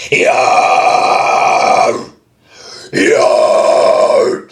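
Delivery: loud breathy raspy yeah; mid scream. Shown as a demonstration. Demonstrating a mid scream he says he can't do. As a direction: push a mid scream from the chest. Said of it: "I suck at mids".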